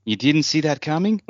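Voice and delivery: high, mocking tease; teasing. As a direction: poke fun at the planner.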